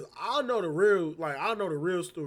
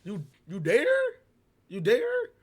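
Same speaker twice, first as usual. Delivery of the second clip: incredulous; 2 semitones higher, 6 dB louder than usual. Retold or imitated. Reacting to stud calling the girl bae, incredulous. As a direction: question it loudly, voice shooting up. Acts out shocked reply to stud.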